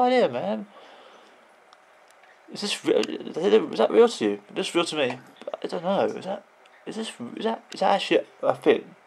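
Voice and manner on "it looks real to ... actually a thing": ordinary delivery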